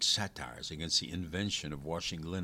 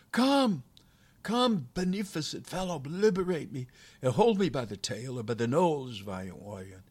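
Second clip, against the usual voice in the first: high, loud calling that eases at the end; shouted plea; 9 semitones higher, 7 dB louder than usual. A narrator's reading. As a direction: shout flattering entreaties to the departing soldier. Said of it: "And then he shouted".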